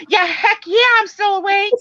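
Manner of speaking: high, eager yeah heck yeah; excited reply. Retold or imitated. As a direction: chirp it out, wide awake and indignant. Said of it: "answering the doctor".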